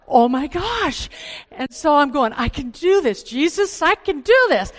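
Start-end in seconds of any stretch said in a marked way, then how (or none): 0.1-1.1 high, loud, drawn-out exclamation; exasperated exclamation. Retold or imitated. Woman retelling her dressing-room mishap, loud comic exasperation. 2.3-4.7 high, loud comic desperation; frantic self-pep-talk. Retold or imitated. Woman stuck in a tight top urging herself, comic desperation.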